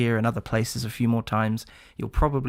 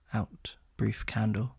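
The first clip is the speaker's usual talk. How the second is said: whispered line; 8 dB quieter, slower than usual. Shown as a demonstration. Whispering Out, brief candle in the Macbeth speech. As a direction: whisper it, slow and hushed. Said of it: "give us a little Shakespearean".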